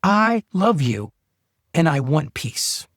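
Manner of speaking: slow, each word set down on its own; slow, deliberate. Shown as a demonstration. Teaching listeners the line for their spouse, said slowly. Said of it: "Memorize this".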